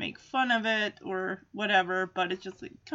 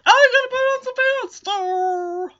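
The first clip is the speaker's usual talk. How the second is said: flustered Jon; 11 semitones higher, 6 dB louder than usual. Retold or imitated. Jon realizing he wears no pants.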